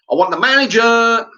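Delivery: high, loud, insistent yell; screaming demand. Retold or imitated. Customer done talking to the worker, screaming. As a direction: shout the demand at full pitch. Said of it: "But I'm screaming".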